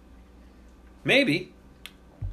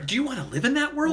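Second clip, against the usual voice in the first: incredulous; 12 semitones higher, faster than usual. Real, not acted. Incredulous rhetorical question.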